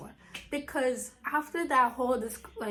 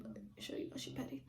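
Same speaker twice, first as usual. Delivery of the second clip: whispered aside; 2 semitones higher, 14 dB quieter, faster than usual. Real, not acted.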